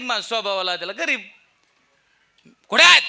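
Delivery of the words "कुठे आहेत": loud high demanding hello; angry phone call. Retold or imitated. Preacher angrily phones his father-in-law, loud.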